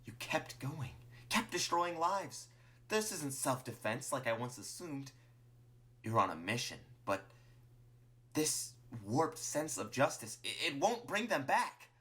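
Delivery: high, distressed accusation with drawn-out words; reproachful Martlet. Acted. Martlet confronts Clover over lives destroyed, upset and reproachful. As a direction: plead and scold, faltering then pushing on.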